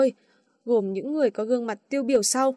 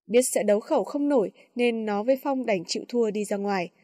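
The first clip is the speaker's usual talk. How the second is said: ordinary delivery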